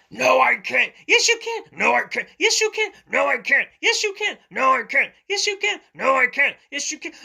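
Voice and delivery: high, loud squabbling voices; stubborn argument. Acted. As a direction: bicker back and forth like kids. Acted back-and-forth quarrel.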